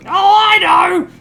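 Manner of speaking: put-on flustered old granny caught with a messy house; old lady voice. Ma greeting her son's surprise guest in a flustered old lady voice.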